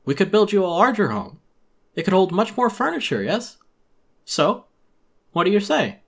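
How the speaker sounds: high, loud, oily shopkeeper pitch; high, loud salesman voice full of fake cheer; Tom Nook voice. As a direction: sell it like a pushy merchant greeting a customer.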